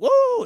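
very high, loud whoop; imitated crowd cheer. Sailors cheering the beer-guzzling comic, loud whoop. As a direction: whoop like a delighted audience.

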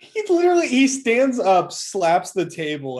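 high, loud, slow build to the table slap; slow high loud retelling of the slam; excited retelling. Real, not acted. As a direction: act out his rage. Retelling a burst of rage.

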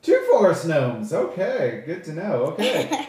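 loud, high stage voice, taken aback; stagey high elf taking in a surprise; high elf speaker. Acted.

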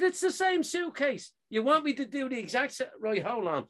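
high-pitched, indignant retelling; exasperated protest. Retold or imitated. Traveller arguing that both suitcases are the same, exasperated.

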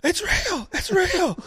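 high, frantic shouting; retold scream. Boy with a broken nose screaming down the phone at his sister. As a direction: yell it at the top of your voice in panic. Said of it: "I start screaming".

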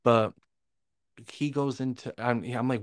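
ordinary delivery

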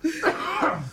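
high-pitched laughing outburst; laughing hard. Real, not acted. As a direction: crack up, squealing. Cracks up at friend's joke.

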